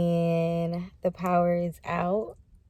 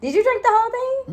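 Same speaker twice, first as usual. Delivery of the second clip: surprised; 5 dB louder, faster than usual. Real, not acted. Friend downed the whole shot.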